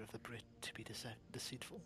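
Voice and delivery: sly conspiratorial whisper. Pretend. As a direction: whisper like a sneaky Brit. Sly whispered British claim.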